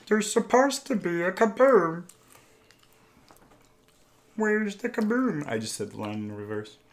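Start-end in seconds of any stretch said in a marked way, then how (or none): 0.1-5.5 slow, high cartoon alien voice; Marvin the Martian. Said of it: "Marvin the Martian".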